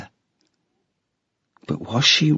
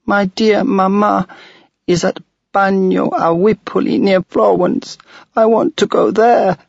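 put-on high, insistent child's plea; a ghost girl begging to go home; pleading little girl; 11 semitones higher, 4 dB louder than usual.

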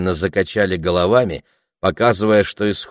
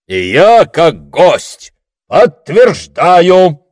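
loud, raised declaration with a stretched ending; booming; 9 semitones higher, 8 dB louder, much slower than usual. A narrator's reading. Guest Sandro vouching before the crowd, booming. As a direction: proclaim it resoundingly. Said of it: "зычно добавил дядя Сандро".

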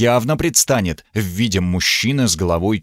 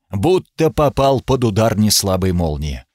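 ordinary delivery, nothing marked

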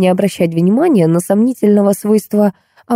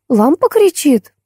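put-on high, slow childish question; little child; 9 semitones higher, slower than usual. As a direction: ask slowly in a high child's voice. Small child asks the nanny. (детским голоском, протяжно)

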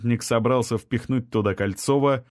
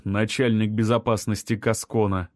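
usual speaking voice

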